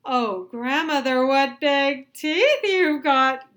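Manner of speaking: put-on high, loud, very slow frightened girl; high, loud, slow scared girl voice; gasping girl. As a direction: gasp it out in fear.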